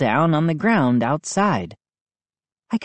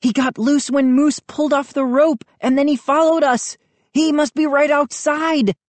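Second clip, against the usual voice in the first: high, excited explanation; exclaiming; 10 semitones higher, 3 dB louder than usual. A narrator's reading. Brother explaining how the dog followed, excited. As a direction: explain it in an excited, raised pitch. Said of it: "I exclaimed".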